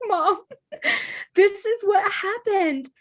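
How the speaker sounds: high, tight voice, half laughing, half crying; tearful retelling. Retold or imitated. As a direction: replay the kid running to mom in tears, laughing at it now. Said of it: "I was immediately in tears".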